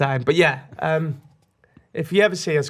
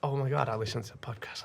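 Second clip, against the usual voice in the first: excited whisper, unvoiced; whispered fan; 4 semitones lower, 10 dB quieter, faster than usual. Fan meeting a podcast host in New York, whispering. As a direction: breathe it out like a secret. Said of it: "was like".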